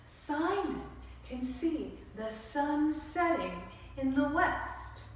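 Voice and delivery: ordinary delivery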